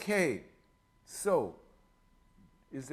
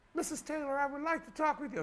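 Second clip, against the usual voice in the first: insurance telemarketer; faster than usual. Retold or imitated. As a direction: mimic a fussy insurance caller, pitched way up. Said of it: "when they go".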